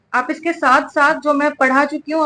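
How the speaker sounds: ordinary delivery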